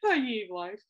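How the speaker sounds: wheezing through laughter; laughing. Real, not acted. Helpless after her own retold joke, laughing. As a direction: gasp it out mid-laugh. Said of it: "laughing fit".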